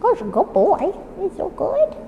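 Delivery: very high, soft coo. Real, not acted. Trainer cooing to the puppy, very high and soft.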